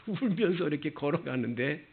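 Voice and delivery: normal talking voice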